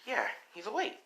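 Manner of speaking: high, chirpy reply; villain girl. Acted. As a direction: answer in a high girlish voice. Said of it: "And she's like".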